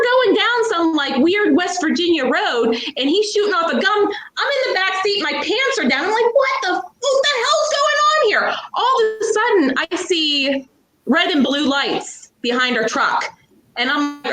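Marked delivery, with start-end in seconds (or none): 6.3-8.5 high, alarmed and bewildered; panicked disbelief. Retold or imitated. In the back seat during gunfire, high panicked disbelief. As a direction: shriek it, caught completely off guard.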